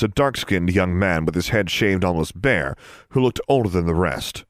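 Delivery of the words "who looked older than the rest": ordinary delivery, nothing marked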